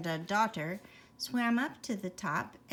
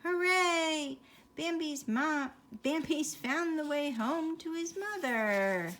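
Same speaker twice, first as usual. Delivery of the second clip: higher, louder cheer; 7 semitones higher, 4 dB louder than usual. A narrator's reading. Fish cheering to each other that Bambi found home. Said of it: "cried to each other".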